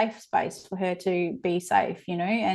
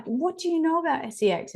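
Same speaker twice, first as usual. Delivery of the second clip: asked again much higher, in alarmed disbelief; a mum's startled, high-pitched question; 8 semitones higher, 3 dB quieter than usual. Retold or imitated. Mum asking her young daughter, higher and alarmed. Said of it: "And I was like".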